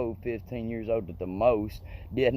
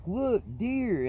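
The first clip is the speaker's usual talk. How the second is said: much higher, startled shout of warning; sudden warning; 12 semitones higher than usual. Retold or imitated. Boy in the car spotting deer, startled high cry. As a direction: yelp it out the moment you spot them. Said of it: "I was like".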